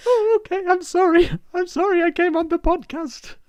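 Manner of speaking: very high, timid apologizing voice; meek apology. Retold or imitated. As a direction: squeak it out like a hurt little guest. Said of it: "And you're just there like".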